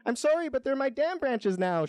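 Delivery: high-pitched apologetic mock shout; shouting beaver. A narrator's reading. Beaver claims the tree's branches, high mock shout. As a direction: argue high, barely raising the volume. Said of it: "shouts the beaver".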